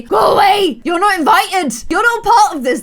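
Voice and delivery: high, loud shooing-off of intruders; annoyed shout. Pretend. Player shooing uninvited townies out of the conversation, annoyed shout. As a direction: shout them away in mock outrage. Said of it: "really starting to annoy me".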